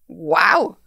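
awed "wow" with a swooping rise; asombro. Real, not acted. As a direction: exclaim it, amazed. Interviewer exclaims in awe.

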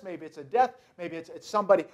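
normal talking voice

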